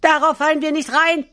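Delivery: put-on very high kid voice calling back; defiant little goats. A narrator's reading. Kids answer wolf through the door, high defiant call. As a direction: pipe up high and refuse. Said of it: "riefen die Geißlein sogleich".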